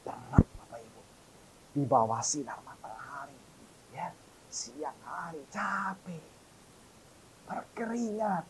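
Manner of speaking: hushed whisper about the hard work; soft whisper, weary. Acted. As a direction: drop to a whisper, drawing them in. Describing tiring farm work.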